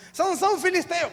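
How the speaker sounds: high, loud warning call. Acted. Delilah calls, Samson springs. (grito de alerta alto e arrastado)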